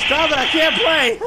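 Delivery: laughing plea. Real, not acted. Gamer begging a teammate to stop joking, laughing. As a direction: beg through real laughter.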